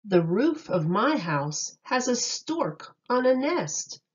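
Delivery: ordinary delivery, nothing marked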